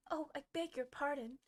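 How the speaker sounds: a touch of alarmed dismay. A narrator's reading. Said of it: "in a tone of great dismay".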